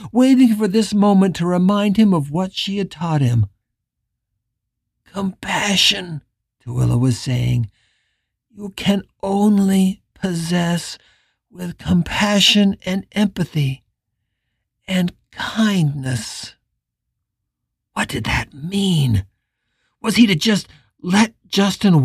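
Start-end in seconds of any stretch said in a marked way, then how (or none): 5.0-16.6 gentle wise teacher. Acted. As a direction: slowly teach with kindness.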